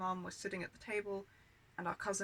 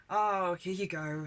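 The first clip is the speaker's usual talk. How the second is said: slow, louder imitated aunt; mock placating; 6 dB louder, slower than usual. Aunt offering upset child small change, mock placating. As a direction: draw out a token offer. Aunt's grudging offer, acted.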